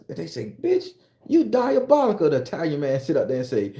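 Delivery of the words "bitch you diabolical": high loud admiring exclamation. Acted.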